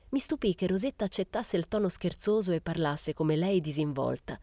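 ordinary delivery, nothing marked

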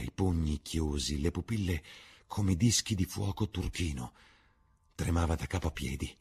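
ordinary delivery

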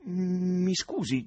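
suspicious. A narrator's reading. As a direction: draw it out with suspicion. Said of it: "disse con sospetto". (scusa lenta e sospettosa)

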